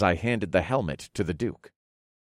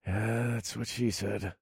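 much softer, slow aside; murmured under breath; 11 dB quieter, slower than usual. Acted. Narrator joking to himself, murmured under his breath. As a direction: mutter the joke to yourself. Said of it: "I murmured under my breath".

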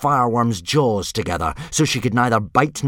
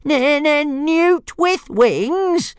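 very high, very slow squeal of disbelief; outraged stammering dragon; 4 dB louder, much slower than usual. Acted. Dragon squealing the insult back in high, dragged-out disbelief. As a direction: stretch it out, shrill with offence.

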